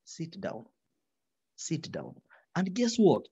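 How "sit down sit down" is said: low, very soft, firm command; quiet command that needs no volume; calm quiet authority. Shown as a demonstration. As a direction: judge softly ordering the court to sit. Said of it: "the legal authority is in".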